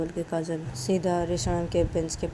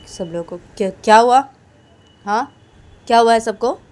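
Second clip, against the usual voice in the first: loud, higher demand; 5 semitones higher, 15 dB louder than usual. Acted. Kajal confronting the staff room, loud demand. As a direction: demand to know what happened.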